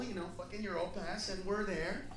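ordinary talk, nothing marked

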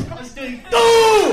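high, loud, slow yell; mocking a nervous crowd, a big slow yell; emphatic shout. Real, not acted. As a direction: belt the punchline out.

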